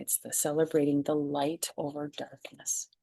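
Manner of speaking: whispered aside; soft confiding whisper. Real, not acted. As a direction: drop to a whisper, just for them.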